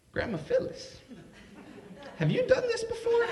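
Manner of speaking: hushed name, then a high squealing question; incredulous question. Retold or imitated. Grandson asking grandma in shock, rising squeal. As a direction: gasp the name, squeak the rest.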